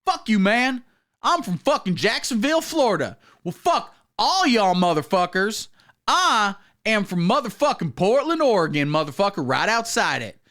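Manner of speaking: put-on high, rowdy hillbilly accent; mock hillbilly accent. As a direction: holler it like a caricature hillbilly. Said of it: "Same thing with the hillbilly accent".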